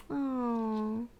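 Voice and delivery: soft cooing aww; tender aww. Real, not acted. Cooing over baby bunnies to a toddler, tender aww.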